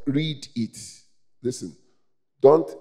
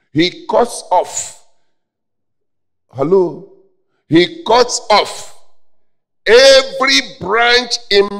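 loud, high, stretched-out preacher's cry; full-force preaching; 9 semitones higher, 10 dB louder than usual. Real, not acted. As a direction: thunder the scripture out slowly.

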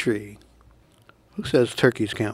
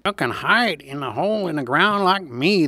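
lightly voiced gopher, a bit higher; a hint of a higher gopher character; 4 semitones higher than usual. A narrator's reading. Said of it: "the gopher said".

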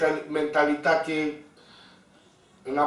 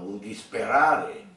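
ordinary delivery

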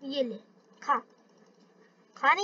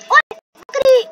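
a sharp, high startled yell; sudden shout; 10 semitones higher, 12 dB louder, slower than usual. Real, not acted. Startled by a goat, a sudden high loud yell.

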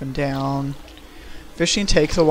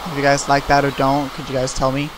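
ordinary talk

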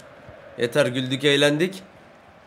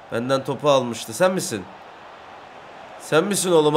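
usual speaking voice

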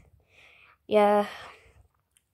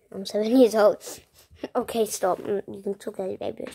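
ordinary talk, nothing marked

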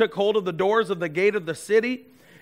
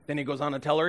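usual speaking voice

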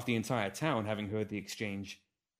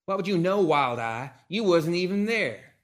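loud, scornful comeback to Wild Eye; Calamity's angry retort; 8 semitones higher, 8 dB louder than usual. Acted. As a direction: bite back at him, teeth bared. Calamity answers Hiccup, bared teeth.

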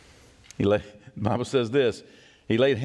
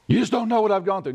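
high, louder protest; defensive objection; 8 semitones higher, 3 dB louder, faster than usual. Pretend. Hurt listener protesting to preacher, raised. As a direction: push back defensively.

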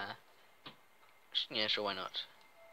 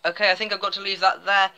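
louder, higher, strained wrap-up; raised voice; 7 semitones higher, 8 dB louder than usual. Real, not acted. Player wrapping up as someone interrupts, raised voice. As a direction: lift pitch and volume over an interruption.

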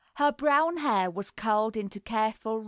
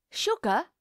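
polite inquiry; 4 semitones higher than usual. A narrator's reading. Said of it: "she inquired with tongs".

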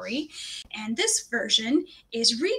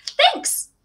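put-on much higher, louder happy girl; bright thanks; 6 dB louder than usual. A narrator's reading. Orphan girl thanking Matthew, high and delighted. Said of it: "said Anne".

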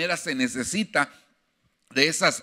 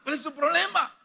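God challenging; 13 semitones higher than usual. Retold or imitated.

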